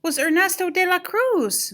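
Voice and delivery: high, excited exclamation; excited cry. A narrator's reading. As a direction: cry it out with a thrilled discovery. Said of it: "Miguel cried".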